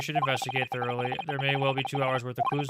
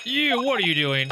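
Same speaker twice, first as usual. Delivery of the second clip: angry shout; 9 semitones higher, 4 dB louder than usual. Acted. Sailor catches intruders at alarm.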